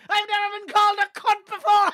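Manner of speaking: high, delighted rich fella voice; posh rich fella. Retold or imitated. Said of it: "like some fucking rich fella".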